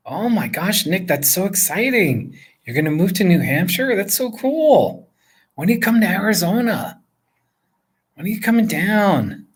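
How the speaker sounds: high-pitched, swooping delight at a friend's news; excited gushing. Real, not acted. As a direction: gush in a high, sing-song lilt. Gushing over viewer's moving news.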